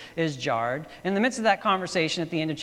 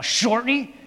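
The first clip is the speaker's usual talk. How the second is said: put-on raised, louder urgent call to the kid driver; Indiana Jones calling out; 8 semitones higher, 6 dB louder than usual. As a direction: shout it as the hero in trouble. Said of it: "and he's all".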